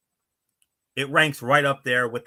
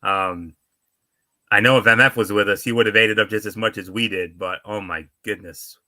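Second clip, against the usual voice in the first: ordinary talk, nothing marked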